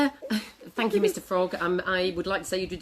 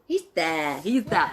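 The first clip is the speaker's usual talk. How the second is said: usual speaking voice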